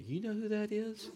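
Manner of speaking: high-pitched mock boasting question; bragging dad. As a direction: imitate a proud dad showing you off. Proud dad bragging in restaurant.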